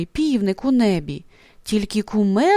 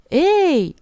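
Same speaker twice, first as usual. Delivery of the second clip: calling out; 6 semitones higher, 3 dB louder than usual. A narrator's reading. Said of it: "гукає хмаринка".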